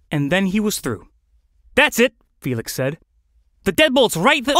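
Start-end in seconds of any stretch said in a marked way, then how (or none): 1.7-4.6 tag. Acted. Urging the flamingo on, cut off.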